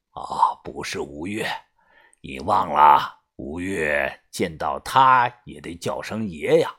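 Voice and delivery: low, soft elder hint; patient gang boss. Acted. Boss hinting at someone greater, low and patient. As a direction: remind him gently in a low voice.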